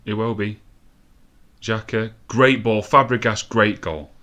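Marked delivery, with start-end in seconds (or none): 2.3-4.1 punchy, louder commentary; loud, pleased football commentary; excited goal call. Real, not acted. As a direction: call the goal with sudden force.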